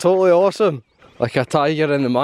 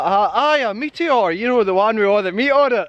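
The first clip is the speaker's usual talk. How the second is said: eager insisting; 6 semitones higher than usual. Retold or imitated. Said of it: "And I'm like".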